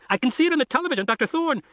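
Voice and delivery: put-on teen boy warning the doctors over the radio, high and urgent; urgent kid on the radio. A narrator's reading. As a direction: play the boy pleading to get in the car. Arby on radio, panicked.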